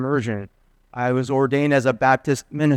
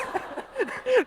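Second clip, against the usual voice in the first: burst of laughter; 7 dB quieter than usual. Real, not acted. Pastor cracks up at story.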